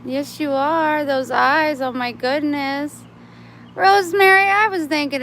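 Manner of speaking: high, loud pet-talk cooing. Real, not acted. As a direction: gush over the cat's eyes. Cooing at a cat.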